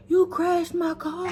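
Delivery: high, slow crying accusation; tearful accusation. Retold or imitated. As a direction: mom wailing about her car. Mom accusing, crying heard.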